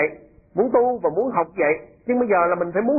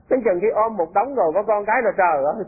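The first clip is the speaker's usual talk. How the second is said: ordinary delivery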